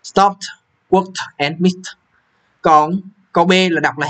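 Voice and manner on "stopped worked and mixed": stopped, worked, mixed with the ed ending said as t. Shown as a demonstration. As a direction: end each word on a crisp t. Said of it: "những cái chữ này phát âm E D là t". (đọc đuôi ed thành âm t)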